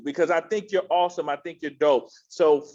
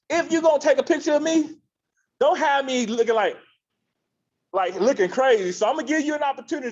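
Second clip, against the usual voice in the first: animated plea; 8 semitones higher, faster than usual. Real, not acted. Playful plea to the photographing audience.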